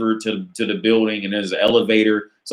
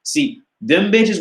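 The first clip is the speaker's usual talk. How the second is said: normal talking voice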